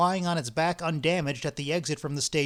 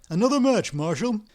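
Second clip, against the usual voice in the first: urgent request; 6 semitones higher, 6 dB louder than usual. Acted. Ostap urges for light.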